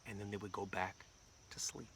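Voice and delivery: soft, low, settling down; hushed narration. A narrator's reading. Storyteller to kids as the town dozes off, hushed. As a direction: let it sink away toward sleep.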